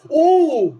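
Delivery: high, loud startled oo; surprised oo. Real, not acted. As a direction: blurt it out in surprise. Reacting to a chance on goal. (yüksek ve yüksek sesli şaşkın bir ünlem)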